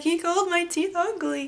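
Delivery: high, whiny tearful wail; imitated crying. Crying patient tells the nurse, in a high mock wail. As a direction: wail it like a hurt child, half mocking yourself. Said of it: "And I'm just crying".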